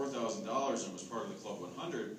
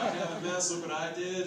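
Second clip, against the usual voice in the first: imitating an excited uncle, louder and higher; raised, delighted mock announcement; 6 semitones higher, 6 dB louder than usual. Nephew retells his uncle proudly telling everybody he has M S, excited. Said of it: "He was so excited".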